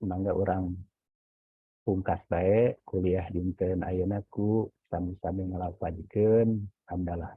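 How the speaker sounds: usual speaking voice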